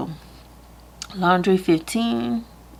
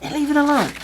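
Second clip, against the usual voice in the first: inner voice; 8 semitones higher, faster than usual. Retold or imitated. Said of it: "it was like".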